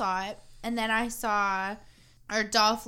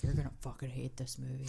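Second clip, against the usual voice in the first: imitating friend; 7 semitones lower, 9 dB quieter, faster than usual. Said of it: "he's like".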